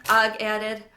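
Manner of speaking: ordinary delivery, nothing marked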